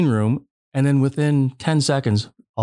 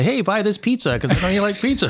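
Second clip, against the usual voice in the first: high, laughing mock leprechaun; lightly voiced leprechaun salesman, giggling through it; playful high leprechaun sales pitch; 9 semitones higher than usual. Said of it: "Is a leprechaun gonna pop up and say".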